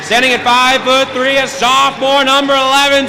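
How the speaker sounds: big booming home team introductions; hyped arena announcer. Acted. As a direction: sell the home starters to the crowd.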